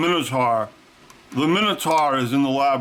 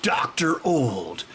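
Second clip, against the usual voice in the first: announcer; much slower than usual. Pretend. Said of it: "Ben Heckendorn is".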